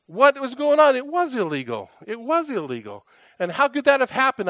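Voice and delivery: high, outraged protest, opening loud; riled up. Retold or imitated. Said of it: "just getting riled up".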